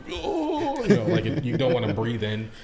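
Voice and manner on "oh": high-pitched mock "ooh" at the cold; shocked cold gasp. Acting out stepping into freezing air, high mock yelp. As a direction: imitate recoiling from icy air in a high voice. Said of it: "it's so cold. You're like".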